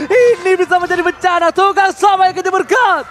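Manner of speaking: loud, high, drawn-out hype calls; hype caster shout. Acted.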